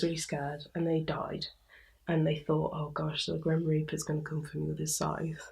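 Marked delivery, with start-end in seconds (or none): 2.7-5.5 hushed, breathless whisper of dread; whispered fear. Retold or imitated. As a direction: whisper it like a scared secret. Retelling a frightened person's thought.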